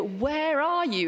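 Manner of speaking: love and anger mixed, searching; anguished cry. Retold or imitated. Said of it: "this is a cry of anguish".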